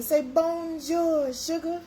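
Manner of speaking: high, slow sing-song say bonjour; coaxing French greeting. Shown as a demonstration. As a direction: draw it out sweetly for viewers. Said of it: "If you're speaking French".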